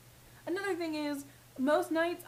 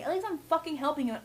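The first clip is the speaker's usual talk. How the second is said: ordinary talk, nothing marked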